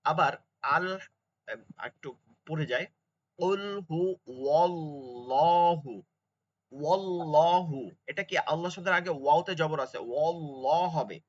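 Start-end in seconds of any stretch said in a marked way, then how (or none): 3.4-7.9 ওয়াল্লাহু slow with a heavy l after the fatha. Shown as a demonstration. Teacher showing the heavy l in ওয়াল্লাহু. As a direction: say ওয়াল্লাহু with a thick l. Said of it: "ল পড়তে হবে".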